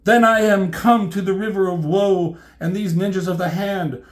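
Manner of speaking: high stage declamation, loud at first; grandiose tragic heroine. Acted. Elektra lamenting at the river of woe, high and grandiose. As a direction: orate it in overblown gothic style. Reads Elektra's florid speech.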